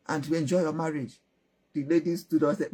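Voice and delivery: usual speaking voice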